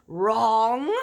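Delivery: loud triumphant cry; giant crying out. A narrator's reading. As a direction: shout it out, slapping a thigh. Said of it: "cried the giant".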